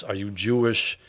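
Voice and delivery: ordinary talk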